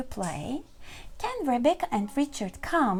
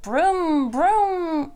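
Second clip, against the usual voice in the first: long, raised brum-brum car sound; squeaky engine noise; 4 semitones higher, 6 dB louder than usual. A narrator's reading. Teacher voices Richard playing with his car, squeaky engine noise. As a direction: play at being a toy car. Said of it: "squeaks Richard".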